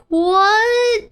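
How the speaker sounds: high, drawn-out shocked cry. Acted. Torako told her car looks pretty, shocked. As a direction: squeal it in alarm. Acts Torako's alarmed reaction.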